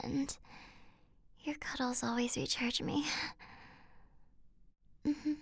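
sleepy tender whisper; soft drowsy murmurs to a lover; sweet nothings. Acted.

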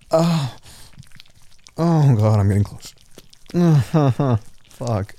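ordinary talk

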